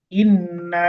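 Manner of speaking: ইন্না with the final vowel held very long; long drawn-out madd. Shown as a demonstration. Teacher showing learners how to read it, long drawn-out ইন্না. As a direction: stretch the end of ইন্না for three counts. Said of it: "একটু বেশি লম্বা করে পড়তে হবে".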